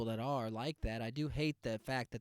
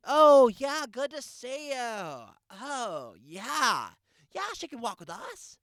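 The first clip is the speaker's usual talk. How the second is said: much higher, gushy imitation of a mom; the woman behind him gushing at her friend, much higher; 10 semitones higher, 8 dB louder, slower than usual. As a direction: coo like a delighted cheer mom. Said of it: "the woman behind me is like".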